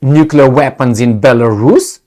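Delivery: normal talking voice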